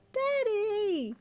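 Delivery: put-on high, loud child wail. A narrator's reading. Little girl wailing for her dad's attention. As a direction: cry out for Daddy, half playing. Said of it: "she wailed".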